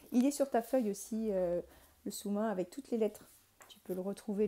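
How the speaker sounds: normal talking voice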